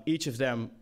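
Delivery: normal talking voice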